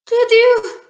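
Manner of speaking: high-pitched storybook grumble; put-on gardener's accusing grumble; grumbling gardener. A narrator's reading. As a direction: complain to the culprit, voice pushed up. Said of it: "grumbled the gardener".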